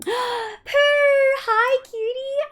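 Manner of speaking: high, loud squeal of surprise; gasp, then squeal hello to a dear friend; slow, high-pitched gushing welcome; overjoyed greeting. Real, not acted. Raid arrives from a friend.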